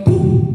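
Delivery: loud vocal "boom" of bursting light; imitated burst. Preacher showing how the light shattered, loud imitated burst. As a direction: punch out an exploding sound.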